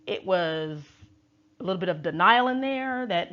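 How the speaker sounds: usual speaking voice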